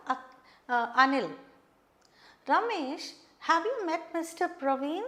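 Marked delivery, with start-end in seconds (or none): 2.5-5.0 "Ramesh" set high, "have you met Mister Praveen" lifting at the end; higher-pitched question, tone climbing on "Praveen"; rising question tone. Shown as a demonstration. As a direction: ask it with the voice going up on "Praveen". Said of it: "Now look at my change of tone".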